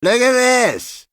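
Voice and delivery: very high, drawn-out crow of triumph; cracked triumphant cry. A narrator's reading. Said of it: "cried Mister Honeyman in his cracked, triumphant voice".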